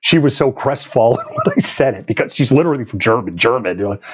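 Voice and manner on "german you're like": ordinary talk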